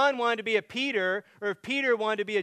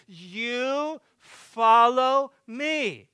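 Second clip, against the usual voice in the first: YOU follow me, each word drawn out, high and loud; emphatic command; 4 semitones higher, 5 dB louder, much slower than usual. Retold or imitated. Jesus cutting off an anxious disciple, emphatic command. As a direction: cut in with a slow, forceful you follow me. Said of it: "This is emphatic".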